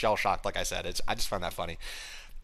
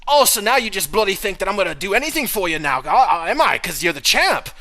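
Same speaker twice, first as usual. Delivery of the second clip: higher, louder indignant voice; mock Finn retort; 8 semitones higher, 6 dB louder than usual. Voicing Finn snapping back at Dom, higher and louder. Finn's Irish-ish retort.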